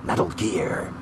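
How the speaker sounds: deep ominous repeat of the name; low mock echo. Echoing in low voice.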